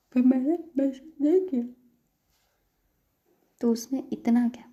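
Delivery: loud, defensive outburst; exasperated retort. A narrator's reading. Employee arguing over a missed message, exasperated. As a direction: push back loudly, as if it were no big deal.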